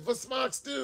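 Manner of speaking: high, loud, proud Wie geht's; bright tourist showing off a phrase; eager German greeting. Retold or imitated. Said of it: "I was like".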